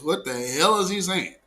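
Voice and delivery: ordinary talk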